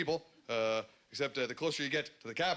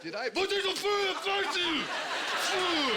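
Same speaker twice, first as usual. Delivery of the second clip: snooty French waiter; 13 semitones higher, much slower than usual. Retold or imitated. Paris waiter answers a request for water, high snooty mock French. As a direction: sneer it like a rude Parisian waiter. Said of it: "asshole-y response".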